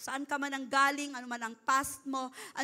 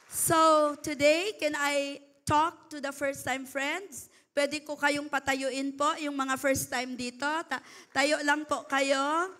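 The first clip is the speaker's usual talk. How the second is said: normal talking voice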